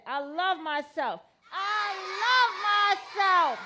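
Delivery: loud slow chant building to a shout; outside voice. Real, not acted. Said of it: "Say it again".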